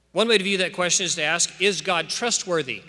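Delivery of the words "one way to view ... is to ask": ordinary delivery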